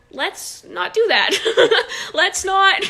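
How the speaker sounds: retold "stop that" broken by laughs; laughing objection. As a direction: laugh through a mock-gentle telling-off. Retells stopping someone, laughing.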